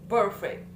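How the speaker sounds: PER of "perfect" punched high and loud; "perfect" hit hard at the start, then dropping; first-syllable stress. Shown as a demonstration. Student repeating "perfect" with the first syllable stressed high. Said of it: "the stress in the first".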